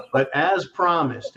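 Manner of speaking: usual speaking voice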